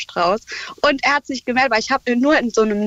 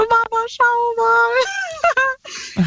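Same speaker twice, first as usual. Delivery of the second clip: much higher, slow, drawn-out call to her mother; excited call to mom; 13 semitones higher, much slower than usual. Retold or imitated. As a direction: call out to Mama, stretching every word in disbelief. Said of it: "Ich so". (der Mama den Blumenstrauß zeigen, hoch und langgezogen gerufen)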